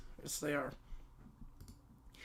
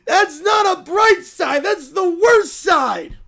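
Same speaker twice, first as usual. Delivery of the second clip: tearful protest; 4 semitones higher, 4 dB louder, slower than usual. Acted. Bear protests, crying.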